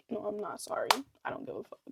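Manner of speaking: unvoiced whispered take-back. Real, not acted. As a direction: whisper the truth aside.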